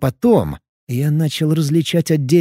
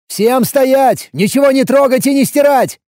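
higher, louder commanding bark; barked order; 10 semitones higher, 5 dB louder than usual. A narrator's reading. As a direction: bark the order to freeze everyone. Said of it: "Буквально рявкнул я".

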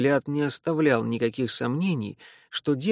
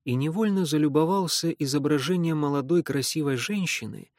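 ordinary delivery, nothing marked

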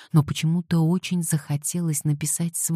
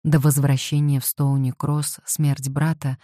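ordinary delivery

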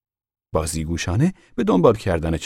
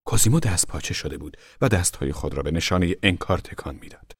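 ordinary delivery, nothing marked

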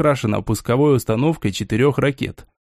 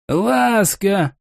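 shout; 5 semitones higher than usual. A narrator's reading. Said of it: "крикнул старик".

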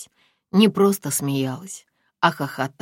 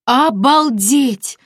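slow, high, loud gasp of shock; 10 semitones higher, 9 dB louder, much slower than usual. Acted. Friend hears about the attack, gasping. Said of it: "ахнула Виолетта".

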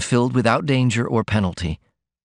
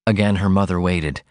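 ordinary delivery

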